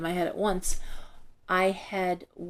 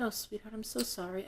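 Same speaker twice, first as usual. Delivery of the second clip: tender sympathy; 10 dB quieter than usual. Retold or imitated. Reader consoles grieving young woman, tender. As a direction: console softly, as retold.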